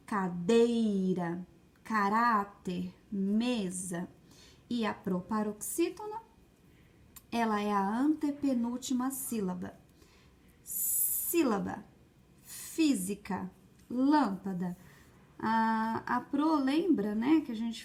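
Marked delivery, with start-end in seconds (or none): 0.1-4.1 slow "Cadeira, caráter, mesa", leaning on DEI, RÁ, ME; stretched penultimate syllable. Shown as a demonstration. Teacher giving pupils examples, "Cadeira, caráter, mesa" with penultimate syllables stretched. 10.6-14.8 slow "Sílaba, física, lâmpada", leaning on SÍ, FÍ, LÂM; stretched first syllable. Shown as a demonstration. Teacher giving pupils examples, "Sílaba, física, lâmpada" with first syllables stretched.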